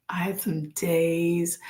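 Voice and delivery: slow, breathy whisper. Real, not acted. Speaker confiding her darkest days to viewers, slow whisper. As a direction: breathe the words out slowly. Recalling the days she couldn't smile.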